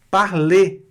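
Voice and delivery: parler with a closed final e and silent r; French parler. Shown as a demonstration. Teacher modelling parler for students to repeat. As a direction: say parler crisp, no r at the end.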